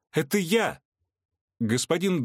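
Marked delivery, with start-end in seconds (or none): 0.1-0.8 much higher desperate call; desperate cry. A narrator's reading. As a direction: call up to him in despair. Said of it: "в отчаянии воскликнула Вероника".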